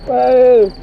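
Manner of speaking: high, loud shout of alarm; startled yell. Real, not acted. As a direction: yell out at the thing chasing you. Startled yell during ghost chase. (teriakan kaget)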